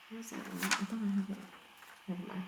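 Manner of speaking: half under the breath, talking to herself; muttering aside. Real, not acted. Teacher searching for formula sheet aside.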